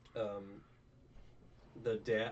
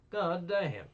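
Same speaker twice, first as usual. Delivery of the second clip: much higher, louder god damn; squeaky god damn; 9 semitones higher, 8 dB louder than usual. Real, not acted. Reacting to the noble, high and loud shock. Repeated exclamations, varied.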